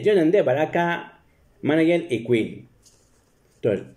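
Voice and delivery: ordinary talk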